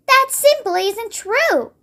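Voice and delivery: put-on high, slow, indignant girl's cry; a touch of angry indignation; cried angrily. A narrator's reading. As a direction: protest with mild narrated anger. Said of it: "Sophie cried angrily".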